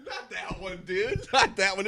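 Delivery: laughing through the words; giggling while repeating it. Retold or imitated. Laughing echo of friend.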